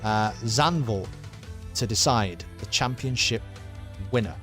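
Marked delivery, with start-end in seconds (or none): none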